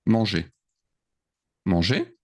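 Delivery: normal talking voice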